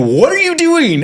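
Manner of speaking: very high, loud accusing shout; suspicious yell. Retold or imitated. Husband bursts in suspecting an affair, shrill accusing shout. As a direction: burst in and demand an answer. Came bursting through the door going.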